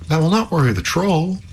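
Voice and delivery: high-pitched storybook princess; warning princess. A narrator's reading. Princess warns Halvor about the troll, urgent warning.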